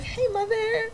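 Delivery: high, soft sweet hey mother; innocent greeting. Retold or imitated. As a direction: sound innocent to mom. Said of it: "I was like".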